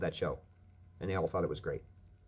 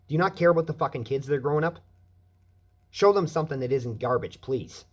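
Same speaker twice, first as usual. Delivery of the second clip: raised, fast, furious demand; loud fast outrage; angry shout; 6 semitones higher, 7 dB louder than usual. Real, not acted. Angry rant about kids and meth.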